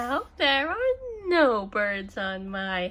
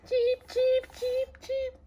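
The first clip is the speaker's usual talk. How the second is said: high, small chirping voice; chick chirps; 13 semitones higher, 4 dB quieter than usual. Acted. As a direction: peep like newborn chicks.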